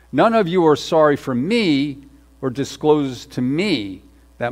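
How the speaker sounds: reading Saul's words and leaning hard on every "me"; "me" punched each time it comes; stress on me. Shown as a demonstration. Pastor reading scripture to the church, stressing "me". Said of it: "I'm gonna put an emphasis, an emphasis on a word there. Me".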